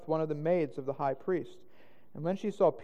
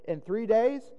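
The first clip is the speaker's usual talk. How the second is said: raised, louder jeer from passers-by; railing taunt; 6 semitones higher, 4 dB louder than usual. A narrator's reading. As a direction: rail at him, high and emphatic. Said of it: "railed on him".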